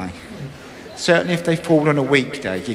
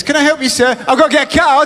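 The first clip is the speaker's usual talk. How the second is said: higher, louder posh shop assistant voice; 9 semitones higher, 4 dB louder, faster than usual. Acted. Comic voicing the card-shop woman asking to help. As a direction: play the prim card-shop lady.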